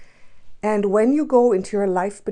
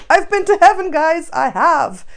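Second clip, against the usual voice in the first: higher and louder, bursting with it; excited declaration; 8 semitones higher, 9 dB louder than usual. Real, not acted. Telling viewers about heaven, a high loud excited burst. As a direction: tell them you've really been there.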